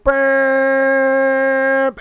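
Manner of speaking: mimicked car horn blast with the mouth; horn imitation. Other driver honking as he turns, imitated horn. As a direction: make the honk sound of his horn.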